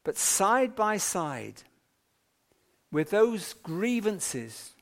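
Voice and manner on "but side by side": ordinary delivery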